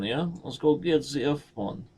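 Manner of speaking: normal talking voice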